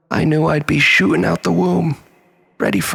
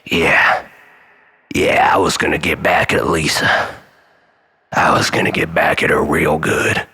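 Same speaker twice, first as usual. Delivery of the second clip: deep, brooding scheming voice; plotting revenge on Lisa, menacing; villainous low voice; 10 semitones lower than usual. Acted. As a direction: growl out the revenge plan low.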